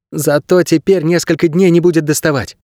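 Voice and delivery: put-on high young lad's voice; boy's voice. A narrator's reading. Boy explaining himself to the injured man, high boyish voice. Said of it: "ответил парень".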